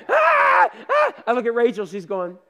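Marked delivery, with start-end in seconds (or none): 0.1-1.1 high, loud terrified shriek; imitated child's scream, much higher and louder. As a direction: shriek like a frightened kid on a dark ride. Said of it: "They're screaming".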